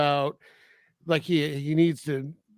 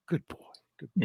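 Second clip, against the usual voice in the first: quietly approving, as if privately; murmured to self; 7 dB quieter, much faster than usual. Retold or imitated. Imitating Santa spying on a boy, murmured approval.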